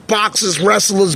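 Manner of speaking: usual speaking voice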